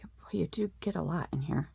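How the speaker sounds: soft, quick whisper to herself. Real, not acted. Admiring a fabric bundle to herself in a whisper. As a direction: murmur it under your breath.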